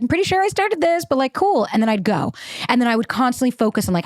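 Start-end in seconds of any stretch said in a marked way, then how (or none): 0.0-1.7 pitched way up, sarcastic and passive-aggressive; mock sarcastic text. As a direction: act breezy while clearly annoyed. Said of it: "I'd be like".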